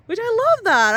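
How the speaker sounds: sudden high, loud squeal of delight; bursting with delight. Real, not acted. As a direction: squeal it like the news thrills you. Delighted outburst about her number.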